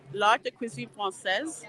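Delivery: French name in a homemade French accent; Louisiana-tinged attempt at a French accent; bad French accent. Real, not acted. Vlogger reads out a menu title, bad French accent. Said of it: "Excuse my really bad French accent".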